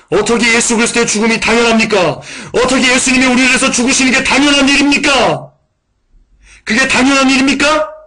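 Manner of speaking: high, loud rebuking cry from the pulpit; impassioned preaching. Real, not acted. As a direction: thunder the question at the congregation. Preacher rebukes congregation rhetorically. (높고 크게 외치는 설교)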